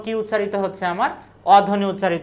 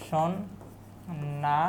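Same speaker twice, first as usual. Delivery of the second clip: very slow, each syllable stretched out, softer; drawn-out demo word; 4 semitones lower, 9 dB quieter, much slower than usual. Shown as a demonstration. Teacher stretching সন্ন্যাসী slowly for students to count vowels. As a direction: spell the word out slowly for the class. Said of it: "এটা আমরা বাংলাদেশে লিখেই যে".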